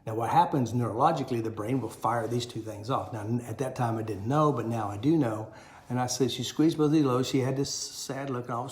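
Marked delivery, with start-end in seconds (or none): none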